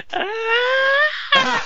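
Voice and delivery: high-pitched mock scream; imitated scream. Man running down the promenade, high mock scream.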